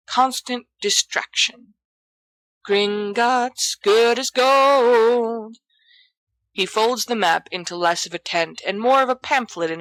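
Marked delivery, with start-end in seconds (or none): 2.7-5.6 strange slow voice. Acted. Odd slogan-like interjection.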